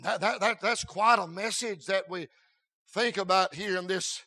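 ordinary delivery

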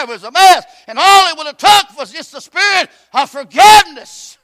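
loud, high preacher climbing to a shout; full-force preaching. Acted. Preacher urging the congregation, loud and rising. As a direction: drive it home from the pulpit.

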